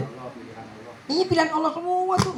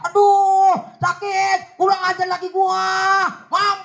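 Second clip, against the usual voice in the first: mock wife's scream; 6 semitones higher, slower than usual. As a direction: cry out in pain and curse the husband. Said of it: "tidak pernah teriak". (teriakan kesakitan yang dibuat-buat)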